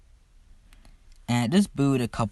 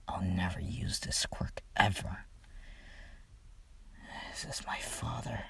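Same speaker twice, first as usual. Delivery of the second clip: softer whispered confession; grim whisper; 4 semitones lower, 12 dB quieter than usual. Retold or imitated. Todoroki blaming his father, bitter whisper. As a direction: confide it bitterly under your breath.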